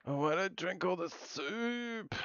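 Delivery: high, slow, soft and sing-song; a goofy little tune about soup; silly high voice. Pretend. Streamer sings a silly line to chat, high and slow.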